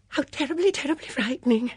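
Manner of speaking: high, fluttery mock fear; thrilled fright. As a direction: squeal with delighted alarm. Thrilled voices in the dark.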